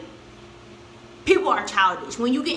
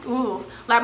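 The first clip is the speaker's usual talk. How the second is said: normal talking voice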